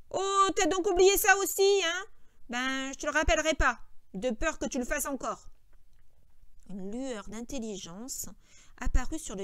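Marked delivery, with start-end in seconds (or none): 0.1-5.5 high, loud jibe easing down; exasperated George. A narrator's reading. George finding Lenny forgot Weed too, exasperated. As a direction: throw it at him, then back off.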